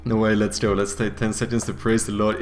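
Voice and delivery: ordinary delivery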